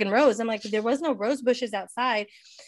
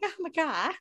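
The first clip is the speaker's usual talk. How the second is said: high, softer mock disbelief; pitched-up, held-back retold excitement; imitating Robert's amazement; 7 semitones higher, 3 dB quieter than usual. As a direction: lightly imitate a starstruck friend. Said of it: "Not quite like me, but".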